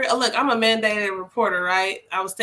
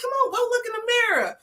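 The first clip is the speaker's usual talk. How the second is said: high, quick, playful coaxing; 12 semitones higher, faster than usual. Retold or imitated. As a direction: urge the boy on brightly. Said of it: "I'm like".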